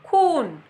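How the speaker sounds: खून with the ऊ held long; खून with a drawn-out ऊ. Shown as a demonstration. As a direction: stretch the ऊ in खून. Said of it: "ऊ का जो स्वर है वो समय ज़्यादा लेता है".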